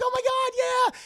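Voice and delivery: very high, loud mock squeal; excited shriek. Mother seeing the cup move, excited shriek. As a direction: squeal in amazed triumph.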